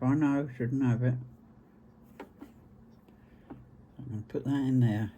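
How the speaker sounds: talking around a pin held in the lips; pin in mouth. Real, not acted. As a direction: speak with a pin between your lips. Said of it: "I've got a pin in my mouth".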